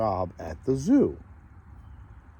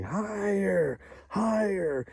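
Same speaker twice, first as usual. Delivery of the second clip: higher-pitched mock cheering of a crowd of kids; 8 semitones higher, 5 dB quieter, much slower than usual. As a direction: egg him on like excited schoolkids. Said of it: "they all started cheering".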